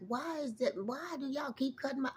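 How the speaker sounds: high, soft plaintive voice; mock plea. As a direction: ask the spirits plaintively. Retold complaint to spirits.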